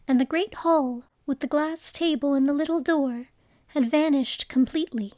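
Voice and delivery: usual speaking voice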